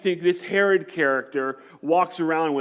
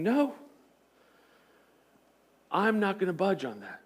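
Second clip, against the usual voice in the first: calm firm refusal; 2 semitones higher, 6 dB quieter than usual. Retold or imitated. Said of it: "he's going".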